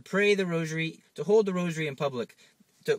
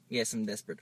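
quiet admission; 4 semitones lower, 6 dB quieter than usual. Pretend. Friend admits desperation.

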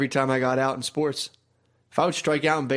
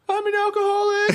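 very high, hammed-up crying voice; mock wailing confession; 3 dB louder than usual. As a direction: wail it like a busted drunk teenager. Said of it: "it was instantly like".

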